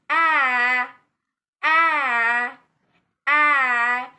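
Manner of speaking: long, loud open A; open A sound. Shown as a demonstration. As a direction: hold the A with the mouth wide open. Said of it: "widely open your mouth".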